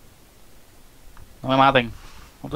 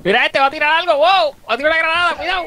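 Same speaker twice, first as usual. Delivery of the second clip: grenade warning yell; 12 semitones higher, 3 dB louder, faster than usual. Real, not acted. Spotting an enemy throwing grenade.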